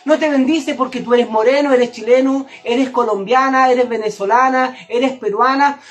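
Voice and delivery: ordinary talk, nothing marked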